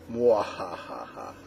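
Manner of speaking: mwahaha villain laugh; evil laugh. Acted. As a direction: cackle like a cartoon villain. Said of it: "Should I do a Doctor Evil".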